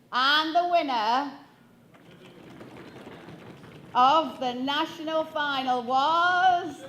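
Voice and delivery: slow, drawn-out announcer build-up; suspenseful announcer. Acted. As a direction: stretch it out to tease the audience. Building suspense before the result.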